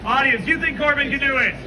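loud showman call to the audience; calling out to crowd. Real, not acted. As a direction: project it to the whole crowd.

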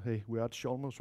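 ordinary talk, nothing marked

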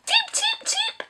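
very high, soft cheeping; high little chick cheeps; bird chirps. Pretend. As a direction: peep like a tiny bird. Bird noise for guessing game.

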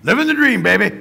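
burly trucker. Retold or imitated. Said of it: "He just rolled right out of his mouth".

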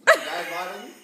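a loud, high laugh after the word. Real, not acted. Repeating the mispronounced biscuit name, laughing loudly. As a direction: crack up over the funny word.

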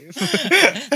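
high laughing burst. Real, not acted. Cohost laughs high at the mangled name.

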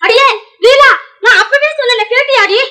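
high, loud telling-off; loud I-told-you-so reproach; angry scolding. Acted. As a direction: burst in scolding her, pitched high. Angrily scolds Leela after the incident.

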